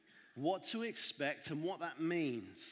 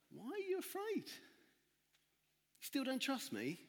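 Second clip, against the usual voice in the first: softer, much higher, very slow questioning; gentle; 9 semitones higher, 5 dB quieter, much slower than usual. Acted. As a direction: ask them tenderly after the storm. Jesus gently questions disciples.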